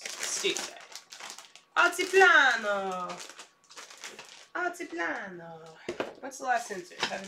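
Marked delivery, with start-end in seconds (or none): 1.8-3.1 Altiplano with a hammed-up Italian accent. Shown as a demonstration. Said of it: "I'm saying it in an Italian accent". 4.6-5.6 Altiplano again with Italian flair, higher; Italian accent. Shown as a demonstration. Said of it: "I'm saying it in an Italian accent".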